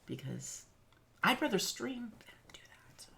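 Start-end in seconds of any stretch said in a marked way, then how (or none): 2.2-3.1 whispered aside while texting; hushed distracted murmur. Real, not acted.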